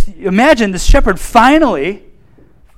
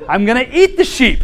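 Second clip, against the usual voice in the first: joking hungry shepherd; 6 semitones higher than usual. Retold or imitated. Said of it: "Da, da, da, you know".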